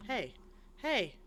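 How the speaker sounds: loud call to get attention; shouting out. Acted. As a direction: yell to someone far off. Guard calling out in game.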